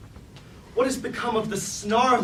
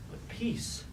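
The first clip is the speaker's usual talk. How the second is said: breathless whisper of relief; 3 semitones higher, 11 dB quieter than usual. Acted. Starscream admitting to himself that he feels peace, whispered. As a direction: let the word escape on a breath.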